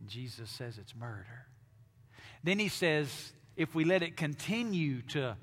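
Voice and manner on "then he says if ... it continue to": preaching louder and higher, rising to full force; building sermon. Acted. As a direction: let it swell like anger spilling out.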